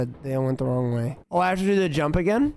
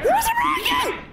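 being chased. Real, not acted. Chased by monster.

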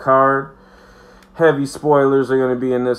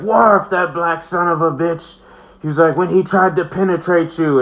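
hammed-up drunk Picard; 7 semitones higher, 4 dB louder than usual.

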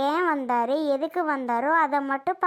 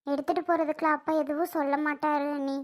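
ordinary delivery

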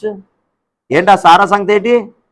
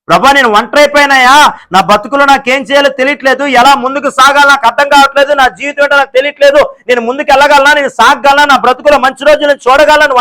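higher, louder pleading lament; anguished plea; 8 semitones higher, 4 dB louder than usual. Acted. Lonely believer pleading with the Lord, higher anguished cry.

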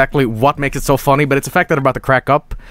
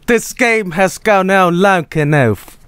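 drawn-out, higher mock British accent; Dennis; 5 semitones higher, slower than usual. Streamer mimicking the clip just played, slow botched British accent. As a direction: imitate a dreadful British accent, slow and overblown. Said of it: "British Dennis".

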